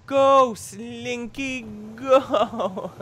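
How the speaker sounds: loud, high, drawn-out cheer; playful cheer. Pretend. Gamer egging on a Slinky, playful cheer. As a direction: stretch each word, breaking into laughter.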